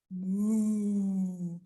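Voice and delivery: low, soft moo; low like a cow; gentle low moo. Pretend. Cow sound imitation.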